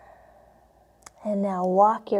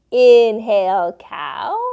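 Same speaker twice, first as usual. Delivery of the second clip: normal talking voice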